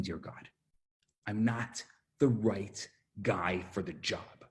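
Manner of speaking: low, measured vow to God; humble sincerity. Retold or imitated. Moses to God on taking the job, humble sincerity. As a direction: soften into a humble admission.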